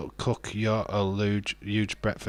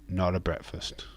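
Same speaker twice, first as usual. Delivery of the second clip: normal talking voice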